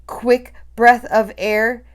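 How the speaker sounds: usual speaking voice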